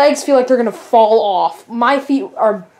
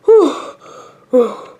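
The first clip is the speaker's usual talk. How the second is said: ordinary delivery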